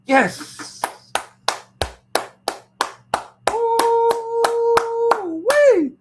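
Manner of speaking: high, loud whooping celebration; mock victory cheer. Him celebrating online that the fight fell through, loud whoop. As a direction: whoop it up like you just won.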